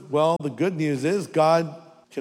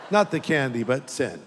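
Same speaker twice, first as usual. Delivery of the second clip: usual speaking voice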